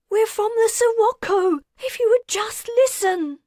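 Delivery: high, loud tearful protest; weeping plea. Acted. Wilder weeping as she pleads with the leader. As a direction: plead through tears. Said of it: "Wilder wept".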